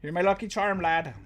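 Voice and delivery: put-on Irish lilt; Irish accent. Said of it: "do [name] in an Irish accent?".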